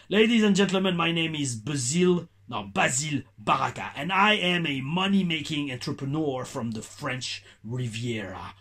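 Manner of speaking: put-on slow English with a thick French accent; Basile Baraka, heavy French accent. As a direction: ham up the French accent, drawing the words out slowly. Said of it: "si je l'avais fait en tant que Basile Baraka, j'aurais fait". (anglais lent avec un gros accent français appuyé)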